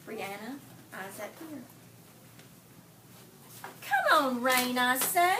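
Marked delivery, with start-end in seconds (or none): 3.8-5.4 calling out loud to the sky, pleading; eager call to the rain. A narrator's reading. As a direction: raise the voice and beg the rain to come. Teacher reads story aloud.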